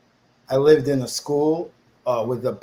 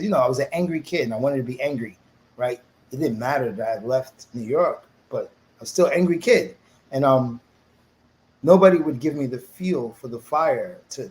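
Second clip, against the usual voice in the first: usual speaking voice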